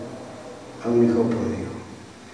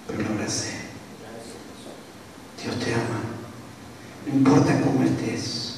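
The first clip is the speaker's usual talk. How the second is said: much softer, intimate and tender; tender hush; 4 semitones lower, 11 dB quieter, faster than usual. Real, not acted. Preacher speaking tenderly to the congregation after the hug, soft hushed. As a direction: drop the voice right down to hold the room.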